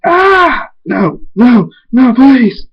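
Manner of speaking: high scream breaking into loud pleading; screamed plea. A narrator's reading. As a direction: shout the plea at high pitch. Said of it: "Big Mac screams".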